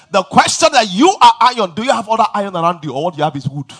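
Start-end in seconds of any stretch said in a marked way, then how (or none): none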